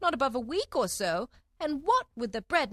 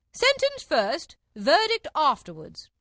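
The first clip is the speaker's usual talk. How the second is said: high, loud, drawn-out decree; imperious Queen; 8 semitones higher, 8 dB louder, slower than usual. A narrator's reading. Queen demands the sentence, loud slow decree. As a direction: decree it loudly and slowly.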